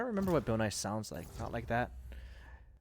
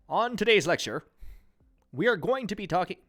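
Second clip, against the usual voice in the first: higher, punchy news anchor; newscaster voice; 6 semitones higher, 3 dB louder than usual. Pretend. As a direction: read it like a TV anchor. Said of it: "it sounds like a newscaster".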